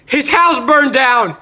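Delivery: loud, high, drawn-out exclamation; slow it down and belt out the punchline; loud comic announcement; gleeful big reveal. Acted. Reveal about former bully.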